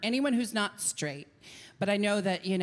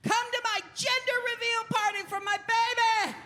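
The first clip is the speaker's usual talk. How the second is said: much higher, over-bright party invite; gushing invitation. Acted. Hostess inviting everyone to her gender reveal, gushing. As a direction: sell the party like an overeager host.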